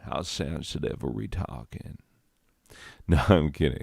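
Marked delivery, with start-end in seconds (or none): 0.0-2.0 mock-sultry murmur, dropping almost to a whisper; saucy voice. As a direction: purr it softly like a hammed-up seduction. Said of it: "I'm not doing that voice".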